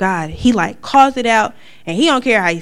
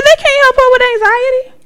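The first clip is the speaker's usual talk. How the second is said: much higher, louder, insistent protest; hammed-up shrieking argument; high insistent retort; 8 dB louder, faster than usual. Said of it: "I said".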